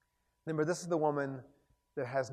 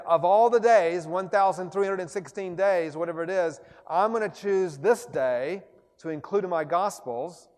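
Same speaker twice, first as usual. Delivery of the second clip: Mark's proclamation; 4 semitones higher, 9 dB louder than usual. Retold or imitated. Preacher voicing Mark's choice.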